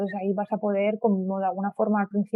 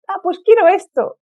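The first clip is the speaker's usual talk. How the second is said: lightly acted, higher and louder quote of herself; retold order; 11 semitones higher, 9 dB louder than usual. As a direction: act out ordering cheerfully, high and loud. Cita su pedido alegre en Camboya. (pedido imitado, agudo y fuerte)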